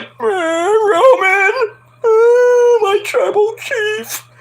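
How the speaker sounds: high, slow blubbering wail trailing into a whisper; mock crying. Streamer mocks a wrestler crying to Roman, hammed-up sobbing. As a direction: ham up a sobbing plea to your chief. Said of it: "Paul starts crying".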